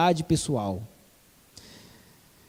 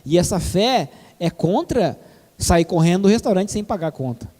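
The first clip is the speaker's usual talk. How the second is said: ordinary talk, nothing marked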